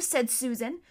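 plain reading voice

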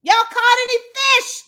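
high, loud call across the water. Acted. Jesus calling from the beach to the disciples, high and loud. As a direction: hail the boat from the shore. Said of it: "He called out".

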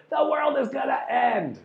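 very high-pitched, hammed-up alarm; mock doomsday cry. Grabbing cat-video watchers' attention, mock alarm. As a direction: wail it like a fake prophet of doom. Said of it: "is if to say".

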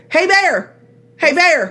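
sing-song "Hey, bear" call. Retold or imitated. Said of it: "they always go like this".

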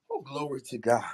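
ordinary talk, nothing marked

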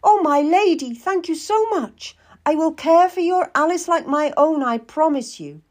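high, overjoyed maidservant; delighted gratitude. Acted. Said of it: "Jane's face lit up".

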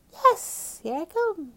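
very high, loud yell; big high-pitched giving-in shout; shouting back. A narrator's reading. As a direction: holler it as you finally charge in. Sam finally gives in, shouting back.

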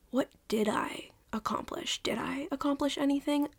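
hushed, unvoiced whisper. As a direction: breathe it like a worried secret. Retelling her own panicked thought.

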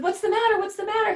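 high alarmed what's-the-matter, said twice; worried mom suddenly on alert; anxious mother on the phone to her sobbing son. Retold or imitated.

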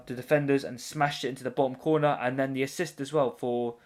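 ordinary talk

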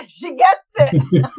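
laughing through the words, high and slow; chuckling as she agrees, drawn out. Real, not acted. Host laughing in agreement with her guest, laughing.

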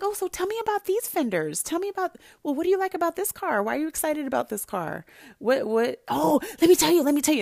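high, fast, eager questions; bright eager interest. Retold or imitated. Asking boyfriend about his car, high and eager. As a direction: play the keen girlfriend asking about fenders. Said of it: "I was like".

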